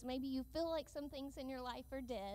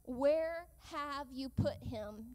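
very slow, louder asking; slow question; 5 dB louder, much slower than usual. Retold or imitated. Jesus asks where his friend lies, slow and heavy. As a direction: weigh every word as you ask. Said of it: "Jesus asked".

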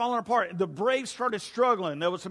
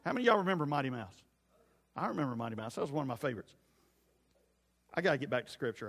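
ordinary talk

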